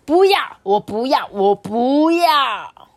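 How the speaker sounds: high, loud whining no; stubborn little owl whining; whiny refusal. Acted. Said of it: "他说".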